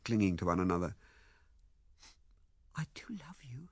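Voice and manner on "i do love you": very soft, breathy declaration; tender whisper. Acted. Ethel declares love in his arms, tenderly whispering. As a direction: whisper it close, in a high light voice.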